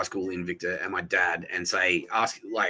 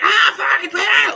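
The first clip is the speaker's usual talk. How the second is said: sudden furious shout, much higher and louder; neighbor's angry yell; 10 dB louder, slower than usual. Retold or imitated. Neighbor yelling during a fight next door, furious shout. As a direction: bellow it like a fight through the wall. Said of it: "and he's like".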